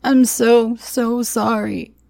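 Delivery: high, wailed remorse, slowing; crying apology. A narrator's reading. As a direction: wail out the apology, loud then easing. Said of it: "he cried".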